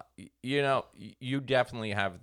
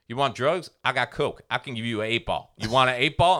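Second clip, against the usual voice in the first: put-on loud eager drunk pitch; pushy, loud and eager; Dave Jolly offering; 5 semitones higher, 7 dB louder than usual. Drunk friend loudly offering drugs to a stranger. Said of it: "He's like".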